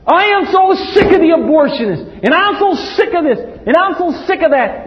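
high, loud, exasperated cry at God; imitating an angry believer; fed-up outburst. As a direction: vent your frustration. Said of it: "you know what you think".

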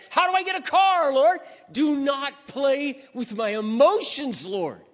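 high, loud, half-laughing plea to the Lord; frantic loud prayer. Retold or imitated. A man with a job but no car crying out to the Lord, high and loud. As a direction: shout thanks then panic up at heaven.

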